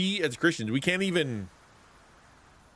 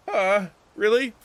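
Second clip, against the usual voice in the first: high, louder incredulous reaction; retold disbelief; 11 semitones higher, 5 dB louder than usual. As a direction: act out a taken-aback "really?". Retold incredulous reply to friend.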